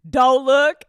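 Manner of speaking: ordinary delivery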